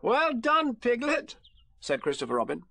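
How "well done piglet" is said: high, pleased praise; bright-pitched congratulation; encouraging. Acted. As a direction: cheer on a little friend. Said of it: "at these encouraging words".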